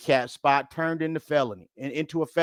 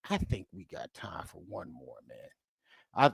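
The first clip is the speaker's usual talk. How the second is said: hushed aside; 8 semitones lower, 9 dB quieter, slower than usual. Real, not acted. Host letting viewers in on one more video, hushed aside. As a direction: drop to a soft, low near-whisper.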